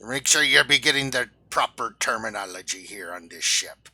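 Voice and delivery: hammed-up salty sea dog; pirate voice. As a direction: play the pirate captain. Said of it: "answer in pirate voices".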